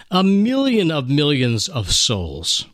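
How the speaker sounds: ordinary delivery, nothing marked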